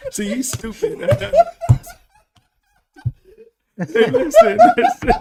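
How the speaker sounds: high, loud laughing speech; words breaking up into big laughs; real cracking up. Real, not acted. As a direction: try to talk through helpless laughter. Cracking up at co-host's joke.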